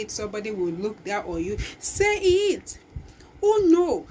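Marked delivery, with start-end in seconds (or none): 1.8-2.8 sudden high, loud push to speak up; urgent call. Real, not acted. Speaker to shy listener, a high loud urging. 3.4-4.1 high, loud startled cry; surprised outcry. Real, not acted. Speaker reacting to the crowd, a loud surprised cry.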